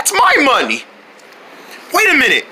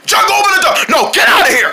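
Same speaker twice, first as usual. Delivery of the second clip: heated shout through the door; shouted demand; 7 semitones higher than usual. Acted. Rivals arguing at a locked door, shouting. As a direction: order them about, worked up.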